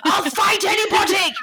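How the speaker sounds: high, loud hammy fighting boast; mock tough shout. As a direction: bellow it like a brawler.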